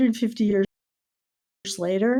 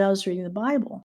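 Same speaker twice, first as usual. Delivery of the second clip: ordinary delivery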